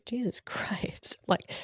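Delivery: breathy whispered Jesus Christ, slow and stunned; whispered aside. Real, not acted. Stunned by a boss's verdict, she whispers slowly to herself. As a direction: let it slip under your breath, stunned. Shocked aside after harsh feedback.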